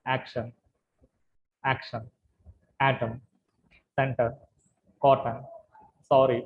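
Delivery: each word first syllable stressed; teacher drilling first-syllable stress. Shown as a demonstration. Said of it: "first దాని మీద stress".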